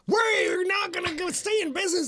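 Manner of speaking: high, shouting used-car commercial voice; hype man pitch. Pretend. Cohost playing a hype man for cheap prices, shouting high. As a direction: sell it like a frantic ad.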